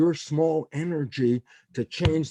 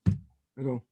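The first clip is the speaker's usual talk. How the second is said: ordinary talk, nothing marked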